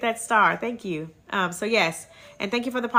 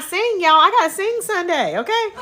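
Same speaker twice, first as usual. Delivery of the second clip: high laughing voice; 9 semitones higher, 4 dB louder than usual. Real, not acted. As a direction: giggle through the words. Joking about singing, laughing.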